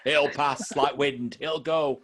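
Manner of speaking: slow, high, dismissive doctor; mock doctor voice. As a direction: drawl it out like a careless doctor. Mocking the doctor's advice.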